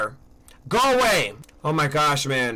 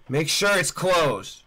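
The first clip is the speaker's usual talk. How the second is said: usual speaking voice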